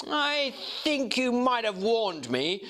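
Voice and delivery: put-on a young man mildly hurt at being kept in the dark, slow and sullen; touch of sullenness. A narrator's reading. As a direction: let a little sulk creep into it. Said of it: "with a touch of sullenness".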